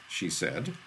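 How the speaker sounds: plain reading voice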